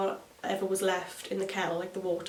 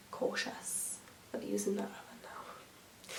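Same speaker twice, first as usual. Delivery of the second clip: slow, soft whisper; sheepish whisper; whispered aside; 13 dB quieter, slower than usual. Real, not acted. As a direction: confide it under your breath. Self-mocking aside.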